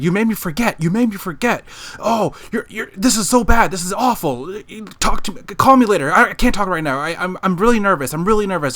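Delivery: imitating a frantic caller, high-pitched and agitated; high, worked-up mock panic; screaming on phone. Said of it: "he starts screaming on the phone call".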